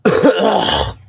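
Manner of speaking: sudden harsh cough; coughing fit. Real, not acted. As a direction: cough hard on something swallowed. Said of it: "I'm pretty sure I just swallowed a bug".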